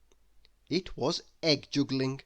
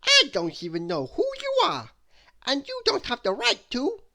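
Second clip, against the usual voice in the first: indignant; 11 semitones higher, 5 dB louder than usual. Acted. Mister Nonsense angrily continues.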